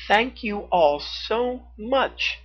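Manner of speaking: put-on high, slow, sweet little girl; sweet thanks. A narrator's reading. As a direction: thank them tenderly and slowly. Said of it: "she said sweetly".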